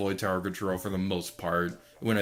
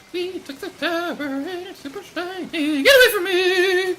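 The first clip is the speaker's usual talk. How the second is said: high squeal; 10 dB louder than usual. Real, not acted. High squeaky exclamations mid-match.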